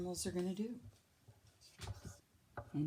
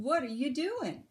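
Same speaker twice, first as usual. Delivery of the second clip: higher, curious raccoon question; 7 semitones higher, 4 dB louder than usual. A narrator's reading. Raccoon asking Bird what he's doing, higher and curious. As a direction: ask brightly and quickly.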